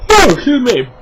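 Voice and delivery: words pushed out through a real yawn. Real, not acted. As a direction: yawn right through the apology. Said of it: "Sorry, I'm yawning".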